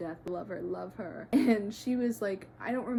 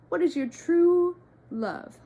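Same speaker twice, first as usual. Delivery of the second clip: ordinary talk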